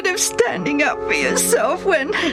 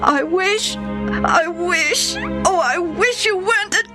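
higher, sobbing wish; crying plea. Acted. Son wishes his father weren't a tax collector, crying. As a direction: beg through tears.

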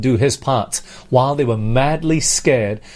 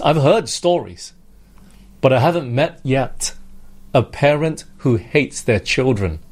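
normal talking voice

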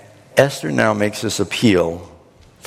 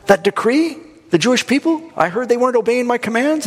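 high, incredulous questioning; king questioning servants; 12 semitones higher than usual. Acted. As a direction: act out a bewildered king checking the facts. Acted king questioning his servants.

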